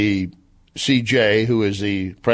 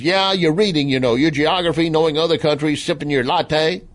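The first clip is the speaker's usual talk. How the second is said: high, scoffing Southern twang; hammed-up Southern list of jeers; mock Southern impersonation; 5 semitones higher than usual. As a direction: pile on the Southern-accented mockery. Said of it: "And Ali continues".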